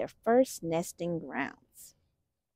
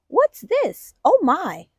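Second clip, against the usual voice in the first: high, loud surprised question; a playful surprise for young listeners; 7 semitones higher, 7 dB louder, slower than usual. Acted. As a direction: gasp out the discovery. Surprised at finding pie.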